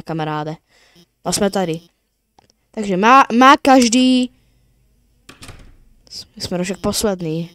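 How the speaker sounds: usual speaking voice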